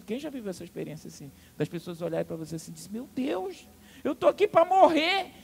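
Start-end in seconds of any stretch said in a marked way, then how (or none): 2.9-5.3 much higher, loud desperate wail; panicked cry. Acted. Frightened person crying out in despair, loud and high. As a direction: feel like you're going to die.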